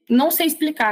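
ordinary delivery

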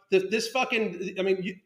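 ordinary delivery

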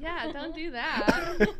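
slow, laughing protest. Real, not acted. Laughing at on-air teasing.

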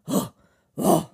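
hammed-up gasps of passing out; faint theatrically with breathy gasps; dramatic fainting gasps. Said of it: "he goes unconscious all dramatically".

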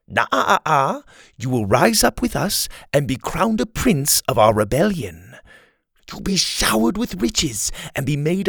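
a scheming rebel leader flattering his captive; Kai, smooth villain. Acted. Kai tempting Dylan.

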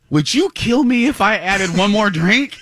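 high, apologetic wheedling; pleading request. Retold or imitated. Acted sheepish plea to barista.

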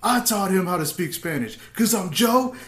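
retold angry roast. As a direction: fire back at a heckler, retelling it. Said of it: "I just got angry".

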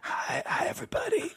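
mock croaky greeting, laughing; croaky sick voice. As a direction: imitate a friend's lost, cracking voice. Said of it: "You're like".

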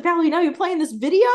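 high, loud incredulous question; hammed-up outraged disbelief; mock indignation. Acting out Caroline scolding Valerie.

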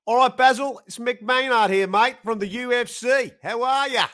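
loud Australian phone greeting; Mick Maynard in Australian accent. Acted. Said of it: "better Australian accent".

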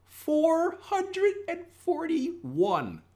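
slow, high, drawn-out amazed voice; awed reveal. Acted. Trader revealing huge return to viewers, slow awed high voice. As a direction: stretch it out in disbelief.

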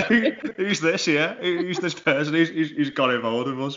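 high, laughing pretend puzzlement; laughing mock bafflement. Co-host playing listeners who don't recognise her, laughing and high.

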